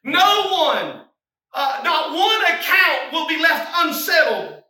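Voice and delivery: loud, high, driving exhortation; rally the congregation; preaching at full force. Acted. Preacher urging the church to give it to Jesus, fervent.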